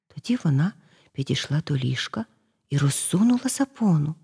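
ordinary delivery

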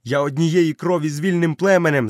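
high, loud protest; boy's desperate loud protest; shouting. Acted. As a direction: cry out in denial. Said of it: "закричав Мауглі".

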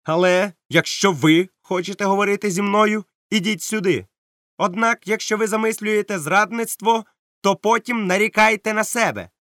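high, loud threat; captain threatening the pirate; stern captain warning. Acted. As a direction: warn him without a flicker. Captain warns Silver.

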